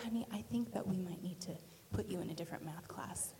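low, hushed, gentle suggestion; kindly teacher voice, kept quiet; sweet teacher. Retold or imitated. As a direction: break it to a pupil softly. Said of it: "she was a really sweet teacher".

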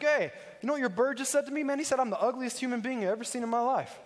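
high-pitched mock indignation; mad customer. Insulted customer to the pet store owner, mock outraged complaint. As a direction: imitate an offended customer complaining. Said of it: "Guy gets all mad".

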